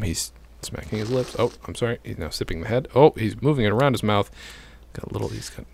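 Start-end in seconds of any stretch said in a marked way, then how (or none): none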